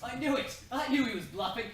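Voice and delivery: loud, high, laughing triumph; gleeful gloat. Acted. Prince gloating loudly that the hero was bluffing. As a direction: crow with delight at the win.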